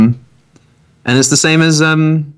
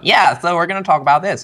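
awkward tech executive voice; 8 semitones higher than usual. Retold or imitated. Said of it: "they're like".

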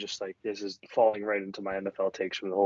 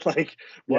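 laughing; 4 semitones higher, 6 dB louder than usual. Real, not acted. Laughing at the blackout story.

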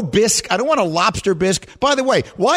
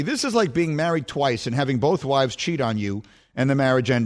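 measured, low reading voice; reading a text aloud; 4 semitones lower, 3 dB quieter than usual. Acted. Host reading a friend's text to listeners, measured. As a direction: read it out like a heartfelt letter.

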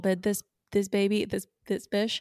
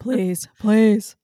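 mock pleading; 2 semitones higher, 9 dB louder than usual. Imitating begging mom for money.

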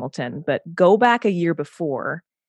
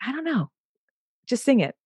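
exasperated director; 8 semitones higher than usual. Retold or imitated. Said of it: "He was like".

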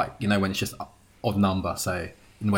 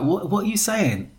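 higher-pitched mock puzzlement; puzzled staff member; 9 semitones higher than usual. Hooters staff puzzled by British accent, higher imitated voice. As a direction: imitate a baffled American colleague. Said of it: "one of them was like".

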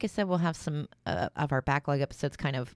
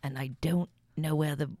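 a light, mostly whispered mock Michael Caine accent; Michael Caine impression; slower than usual. Co-host joins the Michael Caine bit in a mock Michael Caine accent. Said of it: "let's talk like Michael Caine".

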